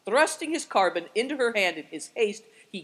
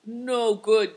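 loud, raised protest; emphatic, higher exclamation; crying out; 4 semitones higher, 7 dB louder than usual. A narrator's reading. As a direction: cry it out in refusal. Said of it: "cried Affliction".

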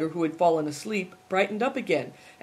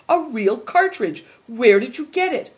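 excited; 6 semitones higher, 7 dB louder than usual. A narrator's reading. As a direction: marvel at it, eager to know.

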